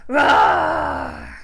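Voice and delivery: loud, low playful lion roar; big deep pretend roar.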